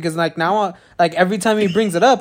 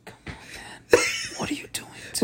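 whisper; 11 dB quieter than usual. Real, not acted. Whispering after a teasing question.

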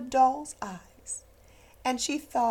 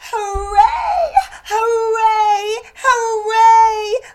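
higher, loud joyous cheers; hooray cheers; 8 semitones higher, 10 dB louder, much slower than usual. Acted. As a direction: shout for joy. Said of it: "cried the funny little clown".

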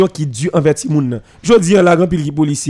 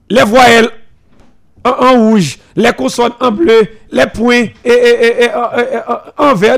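stating the rule firmly; 4 semitones higher than usual. Shown as a demonstration. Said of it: "respecter les principes établis de l'école".

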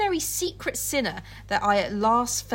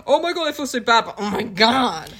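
mock guilty gushing; 2 semitones higher, 5 dB louder than usual. Friend mocking Jekyll's fake guilt, laughing and gushing. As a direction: gush apologies while secretly pleased.

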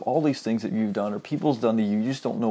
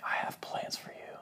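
tender whisper of love, much softer; 3 semitones higher, 12 dB quieter than usual. Acted. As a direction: breathe the words like a loving whisper. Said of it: "Just in that soft, whispering voice of love".

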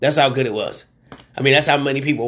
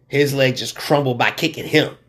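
ordinary talk, nothing marked

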